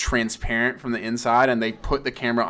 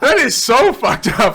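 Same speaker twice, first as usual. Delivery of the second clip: high, loud, slowed through laughter; laughing disbelief; 11 semitones higher, 8 dB louder, slower than usual. Real, not acted. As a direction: crack up while exclaiming it. Laughing at dark movie line.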